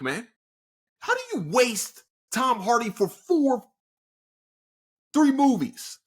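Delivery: high-pitched indignant rant; outraged. Real, not acted. Outraged rant over wasted actor.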